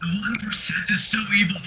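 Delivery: ordinary talk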